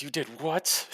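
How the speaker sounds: shocked disbelief. Acted. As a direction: blurt it in wide-eyed disbelief. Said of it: "his eyes go wide".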